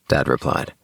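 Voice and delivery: usual speaking voice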